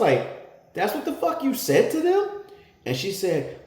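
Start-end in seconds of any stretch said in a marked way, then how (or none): 0.7-2.3 very high, loud disbelieving yell; furious outcry at the wife; outraged shout. Retold or imitated. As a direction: explode in disbelief. Outraged at false gun accusation.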